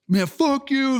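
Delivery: very high, squawked insult; exaggerated imitation of an indignant kid; mock outburst. Kids refused service cursing the owner, mock high-pitched outburst. Retold kids turning abusive.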